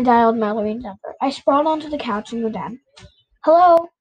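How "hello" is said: a lightly voiced small girl, a bit higher; a hint of a five-year-old answering the phone; small child's voice. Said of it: "said a small voice".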